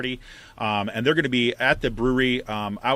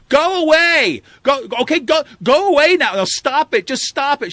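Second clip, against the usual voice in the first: high, loud panicked mock shout; Nancy Pelosi; 10 dB louder than usual. Politician to protesting activists, high mock shouting. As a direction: shoo them off in a high mock yell. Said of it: "so she starts going".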